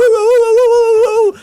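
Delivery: high, loud whooping woos; mock cheering whoops. Mimicking bell-ringing employees, loud high whoops. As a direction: cheer like a crowd gone nuts.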